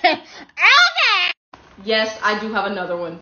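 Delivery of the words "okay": high, loud squawked retort; mocking shriek. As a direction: shriek it in sarcastic dismissal.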